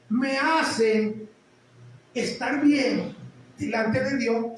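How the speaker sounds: drawn-out, weighty preacher cadence; slow emphatic preaching. Acted. Pastor warns the congregation about earning grace, slow preaching. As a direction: lean on each word to drive the point home.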